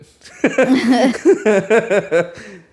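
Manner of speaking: loud, high burst of laughter; real laughing fit, high and loud; long laugh. Real, not acted. Engineer recalling his worst studio scenario, long laugh.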